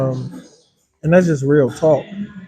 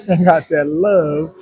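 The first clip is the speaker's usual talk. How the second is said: laughing; 4 dB louder than usual. Real, not acted.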